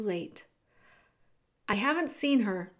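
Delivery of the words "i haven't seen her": plain reading voice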